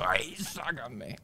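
imitated inner plea. Parent inwardly desperate during a public tantrum, muted plea. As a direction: stretch it out under your voice. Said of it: "tú por dentro dices".

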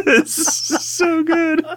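high squeal of laughter, slow; squeaking it out through laughter; laughing. Real, not acted. Cracking up about the worm.

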